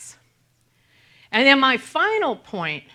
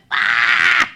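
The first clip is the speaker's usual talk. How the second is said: a quick sharp scream, let it out; short scream; 8 semitones higher, 7 dB louder than usual. Shown as a demonstration. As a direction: scream it once, full release. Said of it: "Really scream".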